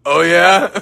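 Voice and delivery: loud laughing retort; laughing oh yeah; laughing comeback. Real, not acted. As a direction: fire back while cracking up.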